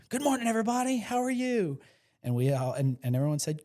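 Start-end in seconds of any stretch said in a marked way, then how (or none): 0.1-1.8 bright, much higher room-filling hello; energetic greeting. Retold or imitated. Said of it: "and he goes".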